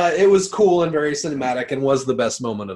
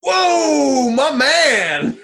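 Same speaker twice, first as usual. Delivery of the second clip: excited shout; 10 semitones higher, 8 dB louder, much slower than usual. Real, not acted. Delighted at low score.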